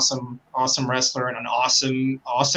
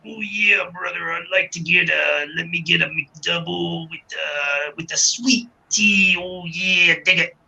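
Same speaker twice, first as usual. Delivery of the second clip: strained wrestler voice at a drive-thru; terrible Macho Man. Retold or imitated. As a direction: order food in a bad wrestling-star impression. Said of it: "That was a terrible Macho Man".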